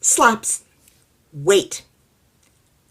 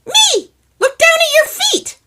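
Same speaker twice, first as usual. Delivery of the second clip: much higher, louder tiny voice; little man shouts; 11 dB louder than usual. Acted. Hidden little man shouting at neighbor, high tiny voice.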